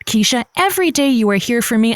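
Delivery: ordinary delivery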